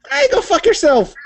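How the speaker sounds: high, bright-sounding happy Irish lilt; cheerful Irish accent. Shown as a demonstration. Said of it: "it sounds like you're happy about it".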